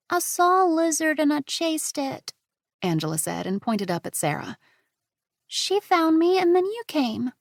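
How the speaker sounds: put-on high chatty five-year-old; a child explaining her adventure; little girl. Angela's voice.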